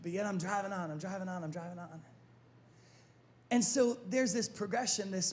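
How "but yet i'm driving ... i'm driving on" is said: low, hushed, trailing away; subdued perseverance. Acted. Disappointed person pressing on into another year, subdued and fading. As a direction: keep repeating it softer and softer, dropping low. Acting out trudging on after disappointment.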